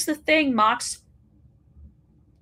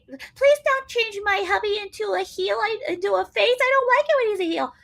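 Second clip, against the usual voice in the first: much higher pleading mock wife voice; whiny mock plea, pitched way up; Renee Paquette; 9 semitones higher than usual. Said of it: "to scream at him and tell him".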